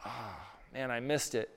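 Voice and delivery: regretful groan. Retold or imitated. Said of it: "they go".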